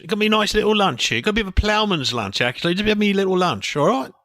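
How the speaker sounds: cheerful working-class gardener. Pretend. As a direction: chat down casually from up the tree. Plays the gardener in sketch.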